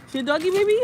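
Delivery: put-on high baby talk to her son. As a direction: coo the question up to the baby.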